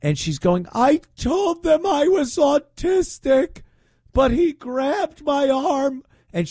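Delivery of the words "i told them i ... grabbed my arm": high, slow, whiny mock sob; mocking a tearful protester, high and slow; mock crying woman. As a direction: imitate her crying, pitched way up and dragged out. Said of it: "she's crying".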